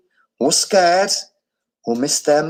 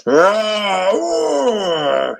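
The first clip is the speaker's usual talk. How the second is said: gorilla roar; 7 semitones higher than usual. A narrator's reading. Gordon roaring as he sees Abu drowning. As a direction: roar with sudden fury. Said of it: "he roared".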